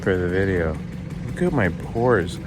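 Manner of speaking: normal talking voice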